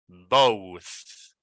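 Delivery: "both" drawn out with a lingering th; final th in both. Shown as a demonstration. Teacher pointing learners to the end sound, careful pronunciation. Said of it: "pay attention to the sound here at the end".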